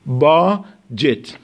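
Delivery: slow, careful BAJ-et, with no d before the j; budget said slowly. Shown as a demonstration. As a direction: spell out باجت slowly as "bajet", silent d. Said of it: "د خونده نمیشه".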